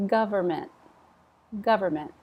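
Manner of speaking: Government with gov stressed and the end dropped. Shown as a demonstration. Teacher modelling government for students, stress on gov. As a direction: hit gov and let the rest fall. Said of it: "Stress on gov".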